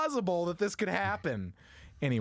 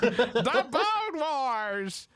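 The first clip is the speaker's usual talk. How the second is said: dramatic exclaim; 8 semitones higher, 4 dB louder, much slower than usual. Acted. To co-host's teasing, a slow laughing grand proclamation. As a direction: stretch The Bone Wars out slowly and laughing.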